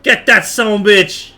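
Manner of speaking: usual speaking voice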